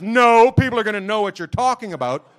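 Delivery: high, loud mocking protest; hammed-up wife protesting loudly; loud objection. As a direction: object hard like an annoyed wife.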